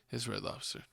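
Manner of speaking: teasing whispered reveal; sly hushed punchline. Pretend. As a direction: whisper it like a secret. Teasing secret to the audience.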